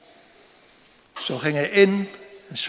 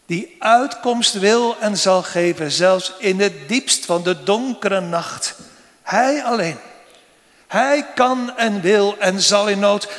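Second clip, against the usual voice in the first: raised, emphatic preaching about deliverance; fervent preaching; 3 semitones higher, 4 dB louder than usual. Acted. Preacher assuring the congregation God gives deliverance, fervent and raised. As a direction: preach it out with mounting conviction.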